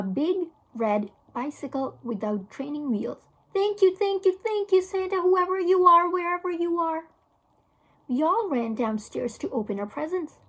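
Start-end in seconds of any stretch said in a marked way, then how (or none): 3.5-7.1 put-on high, loud, breathless little boy; gleeful thanks. A narrator's reading. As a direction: squeal it like a kid who just got his bike. Boy thanking Santa for bicycle.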